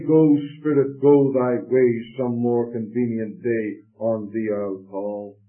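drawn out, slowing toward the end; slow, unhurried send-off; slow dismissive farewell. Acted. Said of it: "Just say".

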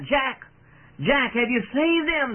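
high, urgent call from the crowd; impatient cry. A narrator's reading. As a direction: cry out impatiently, pitch jumping up. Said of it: "with impatience they cried out".

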